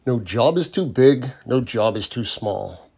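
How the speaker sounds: ordinary delivery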